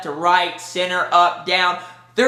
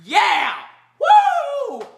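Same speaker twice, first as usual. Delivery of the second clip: high-pitched whooping fan cheer; ecstatic cheer. Retold or imitated. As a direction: re-enact losing it in the cinema. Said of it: "And I was like".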